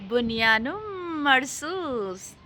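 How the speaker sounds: बुनियानो मरसूस said slow, each syllable dragged as a fumbled name; mock mispronunciation. Shown as a demonstration. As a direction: stumble slowly through बुनियानो मरसूस. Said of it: "नाम pronounce करना नहीं आता". (बुनियानो मरसूस को अटकते, धीरे-धीरे खींचकर बोलना)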